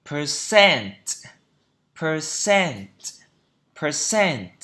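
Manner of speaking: drilled "Percent", weight on the last syllable; second-syllable stress. Shown as a demonstration. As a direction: punch the CENT in "Percent". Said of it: "Trọng âm thứ hai".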